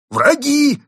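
high, loud cry of enemies; exclaiming. A narrator's reading. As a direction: burst out. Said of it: "воскликнул одноглазый".

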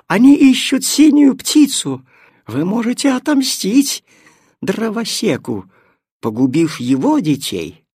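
high, loud scheming cat; inciting. Acted. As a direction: whip them up to revenge. Cat inciting trees to revenge.

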